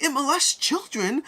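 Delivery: high, slow, drawn-out mock exasperation; hammed-up repeat. Inventor repeating himself to the confused crowd, high mock exasperation. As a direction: spell it out high and slow for the slow listener. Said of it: "The guy's like".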